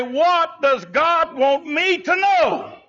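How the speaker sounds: high, slow, searching question to God; earnest question. Pretend. Preacher voicing a believer who longs to know, slow and high. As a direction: ask it as a longing seeker, drawn out. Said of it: "to get to the place to say".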